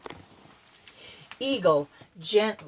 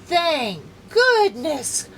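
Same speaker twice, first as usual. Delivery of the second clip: higher, louder, slow relief; relieved sigh; 8 semitones higher, 7 dB louder, much slower than usual. Acted. Missus Johnson sighs with relief after rescue. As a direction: breathe out relief. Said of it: "sighed Missus Johnson".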